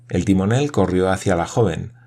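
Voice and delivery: ordinary delivery, nothing marked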